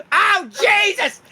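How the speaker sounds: very high, slow, loud pained squeal; Lord Jimothy yelp. Acted. Raccoon king hitting the ground, squealing in high pain. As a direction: squeal out the pain of the fall, drawn out. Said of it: "Lord Jimothy goes like".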